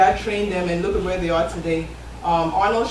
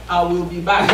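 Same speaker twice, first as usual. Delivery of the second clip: lightly imitated Austrian accent; Arnold Schwarzenegger accent; 2 semitones lower than usual. Speaker quoting Terminator to the audience, lightly imitated Austrian accent. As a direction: quote the film line with a hint of an Austrian accent. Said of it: "just because of that accent".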